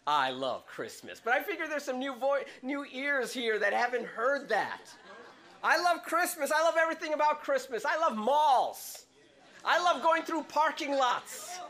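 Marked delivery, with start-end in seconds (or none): 0.1-1.2 slow, lower signature line; slow, quoted catchphrase. Shown as a demonstration. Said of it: "I always say the same thing". 5.6-11.6 high, loud, excited raving about Christmas; gushing. Real, not acted.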